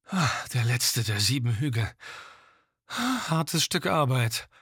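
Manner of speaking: slow, worn-out man; weary male voice after the climb; tired climber voice. Acted. Exhausted climber at summit.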